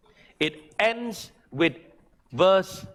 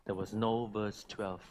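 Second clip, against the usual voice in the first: softer, lower, heartfelt altar call; quiet tender appeal; 7 semitones lower, 5 dB quieter than usual. Acted. Pastor appeals to the church to repent, quiet tender plea. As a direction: draw them in gently, pleading.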